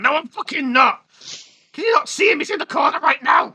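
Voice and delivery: mock frantic shout. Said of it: "He's like".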